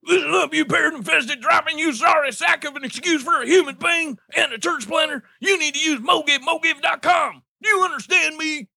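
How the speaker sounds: high screaming drill instructor; drill sergeant yelling. Acted. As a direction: scream orders at the recruit. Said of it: "I'll be the drill sergeant".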